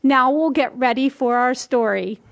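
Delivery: ordinary delivery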